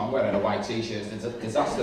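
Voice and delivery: ordinary talk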